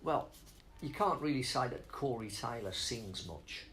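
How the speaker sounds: ordinary talk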